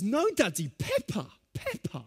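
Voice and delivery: put-on high whiny child insisting; little son refusing a hug, high whining; child whine. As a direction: whine like a small boy glued to cartoons. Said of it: "And he says".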